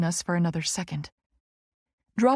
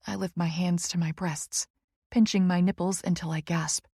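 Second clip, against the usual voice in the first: ordinary delivery, nothing marked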